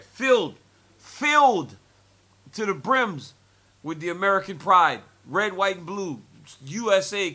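rising loud and high; building excitement. Real, not acted. To the host, recalling the pro-American crowd, excited.

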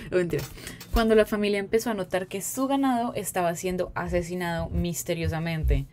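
ordinary delivery

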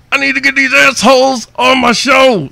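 high, loud, eager yell; a fan shouting how badly he wants them on his show; excited outburst. Real, not acted. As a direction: burst out wanting it badly. Eager to interview the band.

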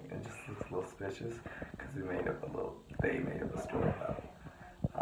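hushed whisper so nobody hears. Real, not acted. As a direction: whisper it, hiding. Hiding in bathroom at 3am.

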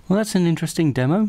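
deadpan the disaster; wry. Real, not acted. After the failed demo, wry joke to viewers.

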